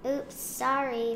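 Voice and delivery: high, slow little oops; drawn-out storybook apology; sheepish apology. A narrator's reading. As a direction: apologize after a clumsy accident, stretched out.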